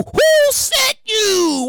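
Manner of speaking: drawn-out, high interrogation; suspicious demand. Acted. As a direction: stretch it out like an accusation. Mock interrogation of strangers.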